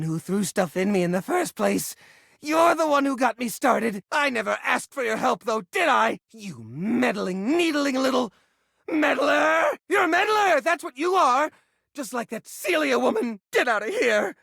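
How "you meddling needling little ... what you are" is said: building insult. Acted. Hoarder scolding the meddling visitor, angry build to a shout.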